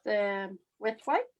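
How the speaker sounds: ordinary talk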